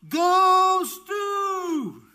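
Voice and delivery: very high, loud, sustained holler; mom's cheering yell. Retold or imitated. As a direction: imitate a sports mom yelling to her son. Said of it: "she would yell".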